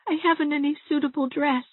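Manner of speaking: high, tearful whine; whimpering excuse. A narrator's reading. Tearful Dovey makes excuses.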